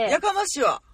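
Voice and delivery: Kansai tsukkomi retort. Pretend. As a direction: throw it out as a mock-annoyed comeback. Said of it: "基本的な関西弁ツッコミワード". (関西弁でツッコミ風に)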